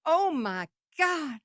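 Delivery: high, breathless gushing Dolly impression, well above own voice; imitating [name]. Telling how Dolly raved about the songs, high and gushing. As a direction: gush like a thrilled Southern star. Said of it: "And she's like".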